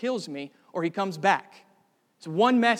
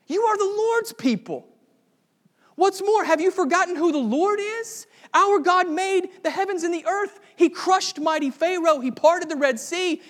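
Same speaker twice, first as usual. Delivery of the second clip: high, loud, fervent rallying cry; young David shaming cowardly soldiers, loud and fervent; young David rallying the army; 9 semitones higher, 5 dB louder than usual. Retold or imitated. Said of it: "It's as if David looks around and says".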